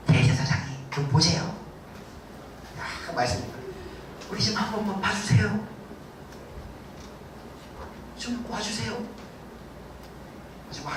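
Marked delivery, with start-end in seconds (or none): 0.1-9.0 soft, humble begging, half-acted; mock polite pleading. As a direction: coax them politely. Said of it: "되게 공손하게 이렇게". (공손하게 부탁하는 흉내)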